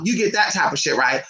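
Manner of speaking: normal talking voice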